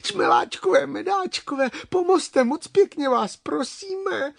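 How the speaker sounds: very high little-creature plea; pleading inchworms. Acted. Inchworms coax the bumblebees to help, pleading. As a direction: beg sweetly in a tiny high voice.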